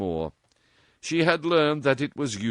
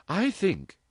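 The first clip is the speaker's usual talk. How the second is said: put-on a higher, playful young woman; lightly voiced cheeky girl; 11 semitones higher than usual. A narrator's reading. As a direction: tease her mother lightly. Dorothy voiced.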